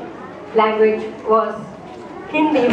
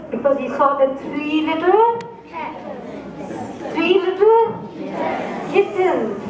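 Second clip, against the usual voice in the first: storytelling narration. A narrator's reading. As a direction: draw the listeners into a fairy tale. Storyteller tells a cat fable.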